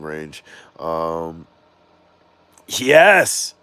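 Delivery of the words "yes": excited. Real, not acted. Cheering a comment.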